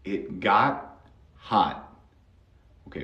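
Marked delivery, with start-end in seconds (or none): 0.0-1.9 "hot" with the final t held and unreleased, very slow; slow it down and stop the air at the end of "hot"; stopped T demo. Shown as a demonstration. Teacher showing students the stopped T, very slow. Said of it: "Listen to how I stop the tea".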